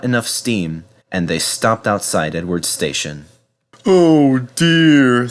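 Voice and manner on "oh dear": raised, sorrowful engine voice; a dismayed little cry from Henry; sad Henry. A narrator's reading. Henry to himself, stuck outside the station, dismayed. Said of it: "thought Henry sadly".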